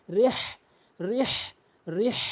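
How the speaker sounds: রেহ said alone with a long breathed throat হ; mid-throat হ. Shown as a demonstration. Teacher drills the big ha, throaty হ in রেহ. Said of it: "বড় হা হলকের মাঝখান থেকে".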